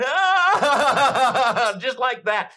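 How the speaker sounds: loud, high anguished wail then a laugh; a weeping man overwhelmed in the aisle, crying out loud and high; imitated crying wail. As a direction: let out a sudden sob-like cry. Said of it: "and he's crying".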